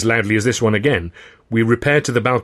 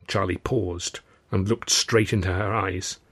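plain reading voice

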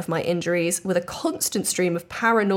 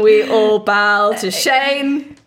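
mock grandeur; 6 dB louder, much slower than usual. Mock-grand ending of story.

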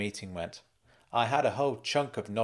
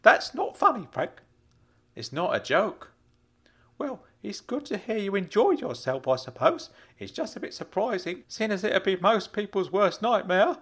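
higher uncle voice, put out; Uncle Claude, disapproving; 9 semitones higher than usual. Acted. Uncle reproves Frank for joking about prison, high-voiced. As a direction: chide him in a raised character voice.

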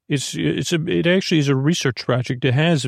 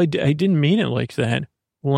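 ordinary delivery

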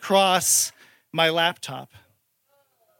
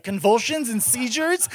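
ordinary delivery

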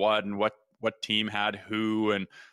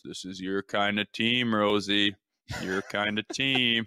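ordinary talk

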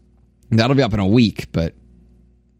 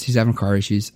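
normal talking voice

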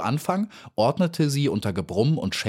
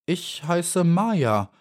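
slow, higher, timid answer; hesitant little bee, drawn out; somewhat shy; 9 semitones higher, much slower than usual. A narrator's reading. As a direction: introduce yourself a little shyly. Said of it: "antwortete die kleine Biene etwas schüchtern".

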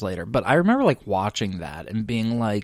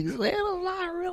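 put-on tiny, very high tearful child voice; squeaky, hammed-up upset child; mock crying kid; 5 dB quieter than usual. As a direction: imitate a little kid in tears. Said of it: "burst into tears".

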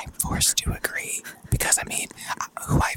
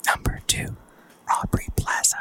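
ASMR whisper. Shown as a demonstration. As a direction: whisper it close to the mic. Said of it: "to emulate them".

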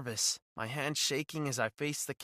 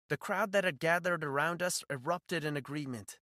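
usual speaking voice

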